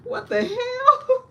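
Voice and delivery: high, slow, drawn-out disbelief; incredulous. Real, not acted. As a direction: stretch it out in disbelief. Incredulous aside about patient.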